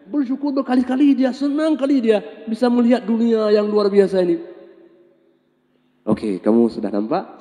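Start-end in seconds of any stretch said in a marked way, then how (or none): 0.1-4.4 joyful telling. A narrator's reading. Retells the joy of sight.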